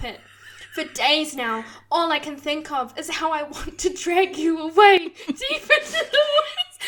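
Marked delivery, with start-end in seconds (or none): 1.9-6.9 giggling through the line; laughing. Real, not acted. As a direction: crack up mid-sentence. Character breaks into laughter.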